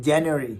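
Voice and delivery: January said JAN-yoo-ree, two light syllables; January, not Janwari; English January. Shown as a demonstration. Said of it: "The right pronunciation would be".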